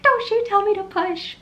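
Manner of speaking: an imitation of her own furious outburst; mad. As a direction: bite his head off. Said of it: "I got so mad at him".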